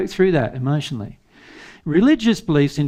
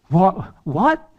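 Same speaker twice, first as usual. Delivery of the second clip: rising disbelief, the second what pitched higher; incredulous what; 4 semitones higher than usual. Real, not acted. Preacher scoffing at agnostics' indifference, incredulous rising what. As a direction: scoff at the idea in mounting disbelief.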